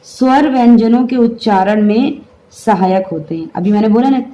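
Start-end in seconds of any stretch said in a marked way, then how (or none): none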